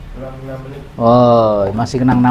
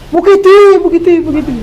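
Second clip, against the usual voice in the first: loud high call across the cliff; 13 semitones higher, 5 dB louder than usual. Acted. Man calling his dead friend's name from a cliff, loud and high. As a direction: shout the name out over the cliff edge. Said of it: "manggil dengan sebutan nama".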